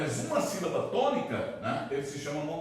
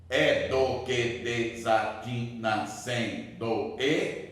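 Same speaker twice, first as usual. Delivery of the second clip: very slow, lower, evenly spaced syllables; 4 semitones lower, much slower than usual. Shown as a demonstration. Teacher showing ten syllables in a verse, syllable by syllable. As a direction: scan the line one syllable at a time. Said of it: "dez sílabas".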